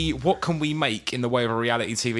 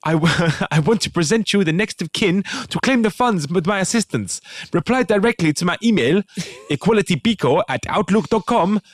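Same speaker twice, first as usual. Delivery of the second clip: mock West African accent; 7 semitones higher than usual. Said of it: "I'm gonna do an accent".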